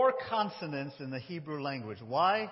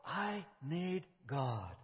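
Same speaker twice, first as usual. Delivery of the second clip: slow quiet plea; 3 semitones lower, 8 dB quieter, much slower than usual. Pretend. Daniel's quoted plea.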